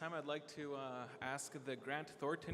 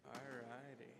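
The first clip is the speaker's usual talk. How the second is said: ordinary talk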